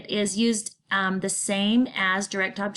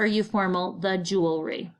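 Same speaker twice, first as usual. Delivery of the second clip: normal talking voice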